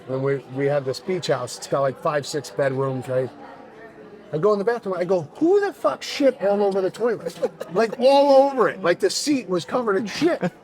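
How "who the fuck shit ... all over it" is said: retold yell. Finding the toilet covered in shit, a mock outraged yell. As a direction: act out the disgusted outburst.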